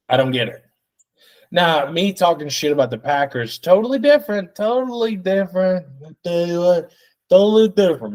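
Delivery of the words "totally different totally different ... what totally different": excited emphasis. Real, not acted. Emphatic repeated phrase.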